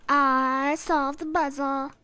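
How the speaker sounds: loud, slow victory cry; triumphant shout. Real, not acted. Streamer shouting in triumph after solving puzzle. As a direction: crow it out loud.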